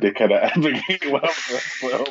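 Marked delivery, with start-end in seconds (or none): 1.2-1.8 short awkward chuckle; nervous laugh. Real, not acted. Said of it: "I'll be nervous laugh again".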